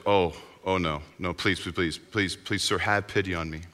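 softly begging, low and hushed; low, quiet mock plea; subdued pleading. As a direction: plead meekly, keeping it small and low. Debtor begs master for mercy.

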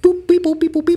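very high beep boop sounds; beeping noises. Retold or imitated. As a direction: chirp out robotic beeps. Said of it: "do fake little, like, scrolling around on my watch".

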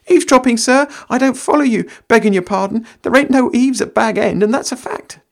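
high, loud protesting country lad; Sam voice. Acted. Sam denying eavesdropping to Gandalf, high and loud. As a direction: play innocent in a high rustic voice. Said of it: "Sam answers Gandalf".

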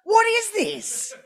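high, slow, drawn-out disbelief; mock disgust. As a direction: ham up your horror at the game. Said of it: "and I'm like".

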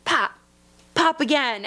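ordinary talk

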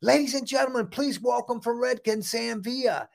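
big, high showman announcement; announcer intro. Retold or imitated. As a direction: introduce yourself like a stage host. Announces himself to the audition.

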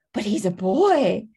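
a touch of mock panic, loud and slow; hammed-up alarm. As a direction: squeal it like a flustered girl. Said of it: "moment of panic where it's just like".

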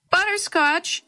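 high, carrying storybook call. A narrator's reading. Girl searching for her lost cat, calling out high. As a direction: sing the name out as if calling a pet. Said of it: "she called".

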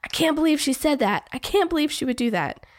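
high, indignant friend voice; sympathetic outrage. Retold or imitated. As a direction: gasp along in shocked sympathy. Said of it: "you're wanting them to be like".

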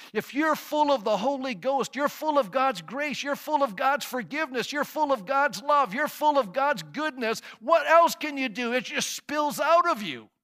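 fervent pulpit build; preaching. Acted. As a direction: pile it on, each line climbing.

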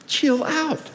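high, lilting, playful soothing; comic high-pitched coaxing; imagined Jesus inflection. Acted. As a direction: lift it high and light to calm her. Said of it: "it might have gone like this".

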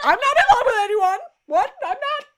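high, loud, hammed-up protest with a laugh at the end; mock indignant denial. Host retelling how Damon denies being in love, exaggerated squealed protest. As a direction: squeal out an over-defensive denial.

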